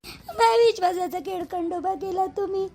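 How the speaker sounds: ordinary talk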